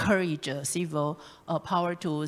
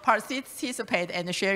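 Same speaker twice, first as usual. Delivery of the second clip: chuckling through the words; laughing; 7 semitones higher, 5 dB louder than usual. Real, not acted. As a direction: laugh while you speak. Laughs while introducing sick colleague.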